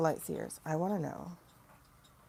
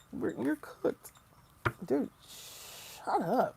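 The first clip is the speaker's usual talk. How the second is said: mock exchange; 6 semitones higher, much slower than usual. Mock exchange about being cooked.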